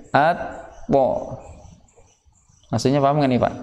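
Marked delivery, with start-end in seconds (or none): 0.1-1.4 "At to" with the to cut off as its own syllable; two chopped beats "At to"; wrong split "at to". Shown as a demonstration. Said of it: "Jangan seperti itu ya".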